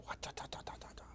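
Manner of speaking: breathy whispered wa-ta-ta; whispered drumroll sound. Real, not acted. Weatherman whispering a silly drumroll. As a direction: rattle it off under your breath. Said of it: "Still don't know why I do that".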